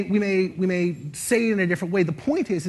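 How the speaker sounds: ordinary delivery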